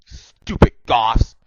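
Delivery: loud, drawn-out put-on voice; angry yell. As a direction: yell it slowly like a cartoon villain. Dumbledore yelling at goths.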